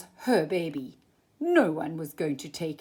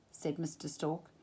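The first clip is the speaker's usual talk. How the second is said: usual speaking voice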